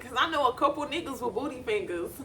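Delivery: cracking up while retelling the joke; high, breaking into laughter through the words; laughing. Real, not acted. Vlogger retelling the booty fingers line, laughing hard. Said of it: "I was just fucking crying".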